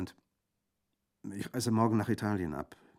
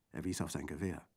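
ordinary delivery, nothing marked

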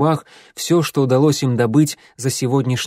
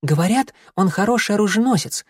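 kitten voice; 9 semitones higher than usual. A narrator's reading.

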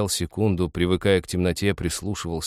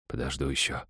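hushed, low thinking to himself; very soft inner monologue; private thought; 5 semitones lower, 8 dB quieter than usual. A narrator's reading. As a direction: think it softly, under your breath. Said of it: "подумал он".

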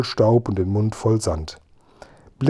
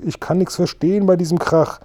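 higher, calling over a roar; shouting over noise; 7 semitones higher than usual. A narrator's reading. Dimpfelmoser complains over the engine racket, high raised voice.

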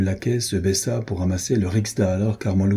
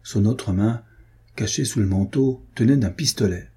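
ordinary delivery

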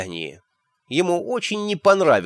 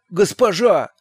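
desperate plea; 7 semitones higher, slower than usual. A narrator's reading. Shawn pleads with Magrat.

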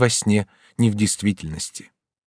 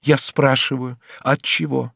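firm, measured questioning; 3 semitones higher, slower than usual. A narrator's reading. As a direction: insist on an answer without shouting. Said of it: "ответил Себастьян твёрдым голосом". (твёрдым голосом)

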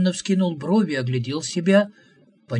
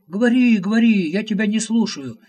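much higher, louder mocking brush-off; taunting; 7 semitones higher, 6 dB louder than usual. A narrator's reading. Prophet taunting the visitor, refusing to listen. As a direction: drown him out with scorn.

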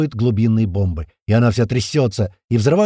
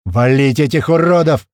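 loud, forceful combat order; battle shout; 5 dB louder than usual. A narrator's reading. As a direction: roar at the crew to attack. Battle order from crew member. (яростный боевой выкрик)

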